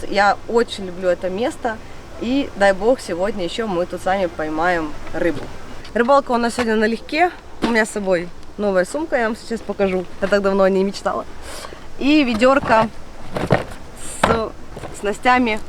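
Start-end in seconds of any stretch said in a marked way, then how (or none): none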